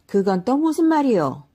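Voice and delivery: high, loud bewildered ask; ask, completely baffled; the farmer puzzled by the old man's warning; puzzled question. A narrator's reading. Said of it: "어리둥절해서 물었습니다".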